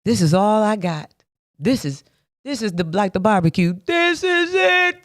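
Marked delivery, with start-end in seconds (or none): 3.8-5.0 drawn-out high proclamation. Real, not acted. Preaching about his faith.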